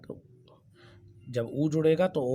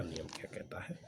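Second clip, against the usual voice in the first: teasing whisper; 9 semitones lower, 13 dB quieter than usual. Acted. Teacher teases the next rule.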